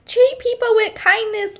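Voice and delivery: high sing-song parody of a platitude; spöttisch hoch nachgeäfft; mocking preachy advice. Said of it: "You know".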